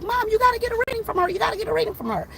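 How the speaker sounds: high, fast daughter pleading with mom; imitated excited urging.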